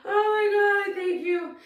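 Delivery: high, loud, crying gratitude; overwhelmed, weepy thank-you; tearful thanks. Real, not acted. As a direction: voice rising into real tears. Overwhelmed by tears, thanking viewers.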